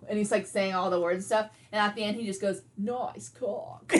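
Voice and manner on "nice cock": Kronk voice. Retold or imitated. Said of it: "in Kronk's voice".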